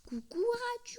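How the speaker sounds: high, sing-song hello to a pet; high cutesy greeting. Acted. Player greets the little creature Ratu, high cutesy coo. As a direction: coo it like to a small animal.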